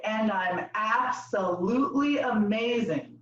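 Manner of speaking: slow, stretched-out declaration; group affirmation. Shown as a demonstration. Leader giving the group a line to repeat, slow affirmation. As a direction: draw out each word for the group to copy. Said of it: "we're gonna say".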